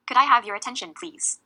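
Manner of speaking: loud, firm request with falling tone on "attention, please"; firm statement. Shown as a demonstration. Chair needing people to pay attention now, firm statement. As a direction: read "Could I have your attention, please?" like a statement, no lift on "please". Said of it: "you can read it like a statement".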